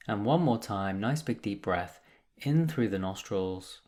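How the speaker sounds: calm, measured meditation guide with long pauses; guided meditation. Acted. Meditation teacher guiding listeners through breath and body, calm and unhurried. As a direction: lead them gently into stillness, leaving space between phrases.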